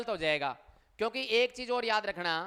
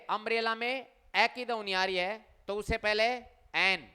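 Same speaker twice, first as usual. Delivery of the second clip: ordinary talk, nothing marked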